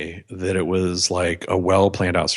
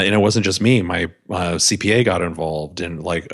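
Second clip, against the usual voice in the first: ordinary talk, nothing marked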